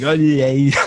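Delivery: a loud, light Bavarian-accented oh yeah; mock Bavarian. Shown as a demonstration. Showing how Bavarians sound in English, loud Bavarian-accented exclamation. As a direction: blurt it like a Bavarian speaking English. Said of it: "it would kinda sound like that".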